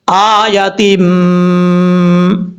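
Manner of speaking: very slow recitation with the nasal sound held one alif long; held ghunna. Shown as a demonstration. Teacher demonstrating the length of the ghunna. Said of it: "ایک الف کی مقدار غنہ کیا جائے گا".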